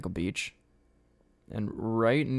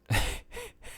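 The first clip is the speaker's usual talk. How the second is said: high laugh; 4 dB quieter than usual. Real, not acted. Laughing at the memory.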